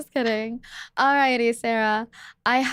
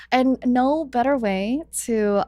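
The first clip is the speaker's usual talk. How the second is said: ordinary talk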